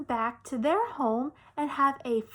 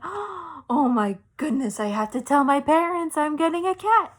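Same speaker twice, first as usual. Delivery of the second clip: louder, higher, excited realization; excited panic; 4 semitones higher, 6 dB louder than usual. Retold or imitated. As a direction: blurt it out in an excited rush. Realizing she must tell parents.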